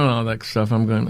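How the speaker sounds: usual speaking voice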